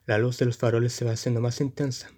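ordinary delivery

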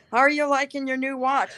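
son's voice, imitated. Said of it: "he says".

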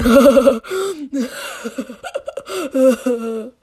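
long helpless laugh; long laughter. Real, not acted. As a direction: keep laughing.

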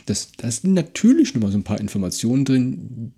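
ordinary talk, nothing marked

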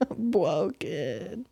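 stretched-out teasing repeat; mocking echo. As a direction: mimic your friend, drawn way out. Mockingly echoes friend's soft remark.

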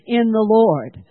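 slow and loud, leaning on hope; preacher's loud refrain; loud repeated declaration. Shown as a demonstration. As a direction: declare it again with force. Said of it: "Say it again".

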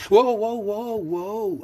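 loud high backing-off whoas; mock alarmed, backing off; mock surrender. A narrator's reading. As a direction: throw your hands up with your voice. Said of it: "in mock surrender".

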